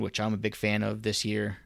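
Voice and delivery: ordinary delivery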